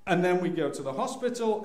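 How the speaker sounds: normal talking voice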